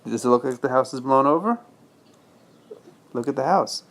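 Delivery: usual speaking voice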